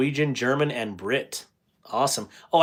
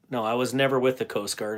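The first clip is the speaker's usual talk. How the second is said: usual speaking voice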